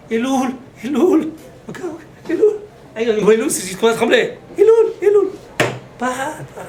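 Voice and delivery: high, loud trembling Elul; old man's cry. Retold or imitated. Old man in the retirement home crying out Elul, high and loud.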